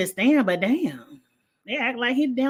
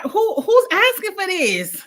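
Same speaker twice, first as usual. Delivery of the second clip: high, slow, put-on disbelief; a hammed-up who would ask for this; mock incredulous; 10 semitones higher, 4 dB louder, slower than usual. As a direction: play it like nobody could want this. Mocking the viewers' request.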